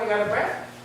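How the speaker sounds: ordinary talk, nothing marked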